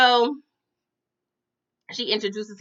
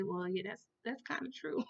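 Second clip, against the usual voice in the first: much softer, trailing aside; sheepish concession; 16 dB quieter than usual. Retold or imitated. Storyteller admitting the mom has a point, quietly sheepish. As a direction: drop the volume right down and concede.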